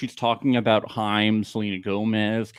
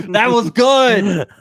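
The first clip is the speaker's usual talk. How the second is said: excited praise; 11 semitones higher, 10 dB louder, slower than usual. Real, not acted. Delighted praise of a zinger.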